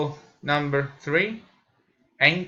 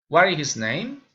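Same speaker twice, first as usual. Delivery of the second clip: ordinary delivery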